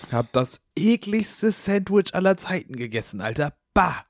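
high, disgusted complaint; grossed out about the sandwich. Real, not acted.

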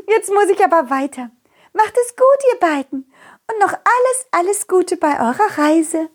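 high, giggly princess voice; embarrassed giggling princess. Acted. Princess character voice.

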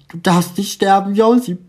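high tearful cry of Josie; tearful pleading. A narrator's reading. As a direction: beg her not to die, near sobs. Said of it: "Sie beginnt zu heulen".

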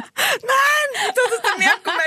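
high, laughing insistence; giggly childlike protest; excited shout. Pretend. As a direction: shout it like a sure little kid.

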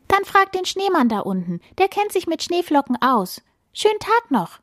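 high, chirpy titmouse voice; twittering. A narrator's reading. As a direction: chirp it like a little bird. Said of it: "zwitschert die Kohlmeise". (hoch gezwitschert)

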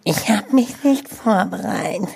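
hushed confession in a whisper. Real, not acted. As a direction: whisper it so the mic barely hears. Whispered aside to co-host.

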